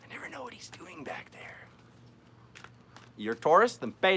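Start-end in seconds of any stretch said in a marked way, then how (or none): 0.0-1.7 hushed whispered aside. Real, not acted. Reader whispers an aside about her partner. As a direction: whisper it behind his back.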